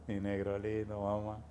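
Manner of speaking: soft, low crooning endearment; tender aside. Acted. Preacher croons an endearment to the congregation, soft. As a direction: croon it gently.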